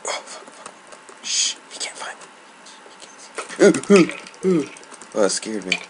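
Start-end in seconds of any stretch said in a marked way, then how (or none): 0.1-2.2 hushed whisper while hiding; real nervous whisper. Real, not acted. Hiding from the mob. 3.7-4.7 high, loud and slowed; sudden loud exclamation; loud slow outburst. Real, not acted. Still hiding, then bursts out.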